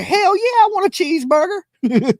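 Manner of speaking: eager yes. Pretend. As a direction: jump at the offer, squealing high. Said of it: "I'm gonna be like".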